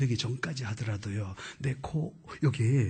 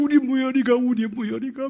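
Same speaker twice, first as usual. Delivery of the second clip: tearful; 8 semitones higher, 6 dB louder than usual. Retold or imitated. Teacher weeping, voiced.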